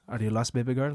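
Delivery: low sultry put-on voice; low and soft, teasing. Repeats catchphrase in voice.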